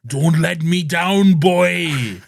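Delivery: put-on loud, slow, booming Welsh accent; big theatrical Welsh plea, drawn out; Welsh dad. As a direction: bellow it as a hammed-up Welsh father. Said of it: "He's very Welsh".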